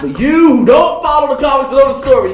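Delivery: high, loud hammed-up scolding; mock shout. Scolding people who don't know the comics, mock shout. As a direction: shout it at them in playful exasperation.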